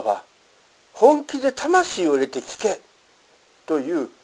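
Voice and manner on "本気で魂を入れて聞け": high, urgent quoted command; forceful exhortation, voiced as a quotation; intense appeal. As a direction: urge the listener in earnest, pitched up. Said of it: "強烈なアピールだ".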